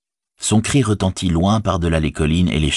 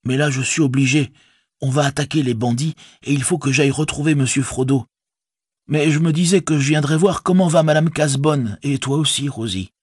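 higher-pitched, explaining hobbit voice; sheepish Sam; 9 semitones higher than usual. A narrator's reading. Sam explains his visit to Rosie, abashed. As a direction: excuse yourself in a high earnest voice.

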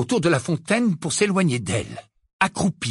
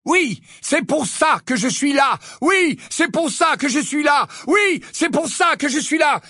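echoing shout; 11 semitones higher than usual. A narrator's reading. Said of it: "crié après lui".

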